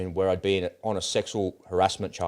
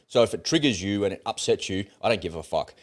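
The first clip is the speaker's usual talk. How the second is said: normal talking voice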